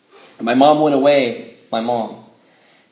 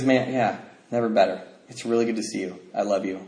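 soft, lowered, sincere voice; gentle reassurance; 4 semitones lower, 5 dB quieter than usual. Retold or imitated. Brother home from treatment greeting his brother, gentle and calm. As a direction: ease down into a tender murmur. Changed brother speaks tenderly.

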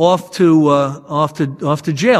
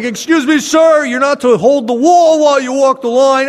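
stern cop; 6 semitones higher, 4 dB louder than usual. Retold or imitated. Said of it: "They're like".

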